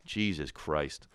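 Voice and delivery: ordinary talk, nothing marked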